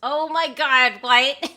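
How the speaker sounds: loud, slow oh my god, then a loud what; shocked. Real, not acted. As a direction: react to a shocking request. Shocked by hero request.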